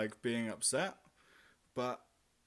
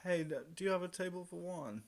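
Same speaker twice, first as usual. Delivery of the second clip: forced bright request; 8 semitones higher than usual. Retold or imitated. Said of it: "and I'm like".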